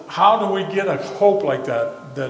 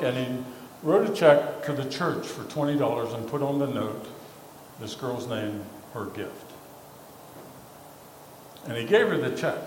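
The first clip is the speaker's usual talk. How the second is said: ordinary delivery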